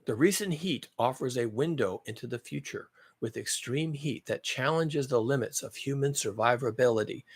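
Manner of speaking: slow, soft, relishing read; savoring the line. Shown as a demonstration. Reading his favourite line to viewers, slow and relishing. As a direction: let each word land slowly. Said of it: "I like this part, though".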